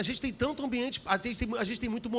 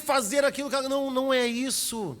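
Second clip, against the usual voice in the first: preaching crescendo; 5 semitones higher, 4 dB louder than usual. Acted. Preaching at full force.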